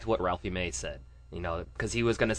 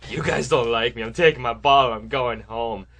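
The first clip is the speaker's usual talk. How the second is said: loud, mock sulky refusal; petulant politician; 4 semitones higher, 6 dB louder than usual. Leader refusing to be kicked out, mock petulant. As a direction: act out a sore loser storming off. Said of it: "and he went".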